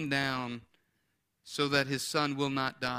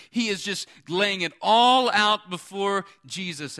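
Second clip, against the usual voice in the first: higher, building preacher delivery; emphatic preaching; 5 semitones higher, 6 dB louder than usual. Real, not acted. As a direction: build to a loud, forceful peak.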